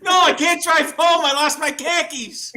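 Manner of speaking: shouted, high Boston parody; exasperated Bostonian yelling with dropped R's; Boston accent. Acted. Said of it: "Boston's the only place".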